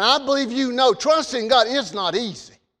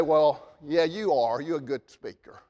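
mock agreeing; 7 semitones lower, 6 dB quieter than usual. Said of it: "God didn't say".

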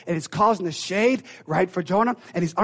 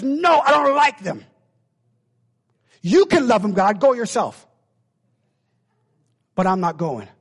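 loud stubborn refusal; 4 dB louder than usual. Acted. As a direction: dig in your heels against God. Jonah voiced refusing God.

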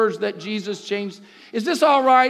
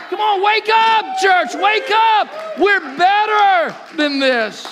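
shouting preacher; 5 semitones higher than usual. Acted. Preacher calling the church to wake up, shouting. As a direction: cry it out to wake them up.

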